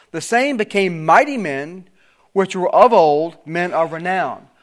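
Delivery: preacher reading the King James text aloud; scripture reading. Acted. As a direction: proclaim the verse with solemn weight.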